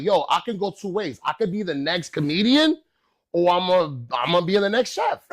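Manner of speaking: high, loud and eager; acting out a big revelation; excited realization. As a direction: declare ambitions with hype. Excited realization of two careers.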